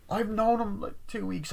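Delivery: high-pitched imitated answer; mock sheepish admission. Girl admits how briefly she's known him, mock sheepishness. Defensive answer in retold argument.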